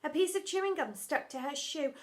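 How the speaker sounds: ordinary delivery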